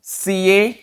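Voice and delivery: "सीए" as two letter names, C and A; repeat-after-me model. Shown as a demonstration. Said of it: "alphabet का तीसरा letter होता है जी सी". ("सीए" को सी-ए, ऊँचे सुर में)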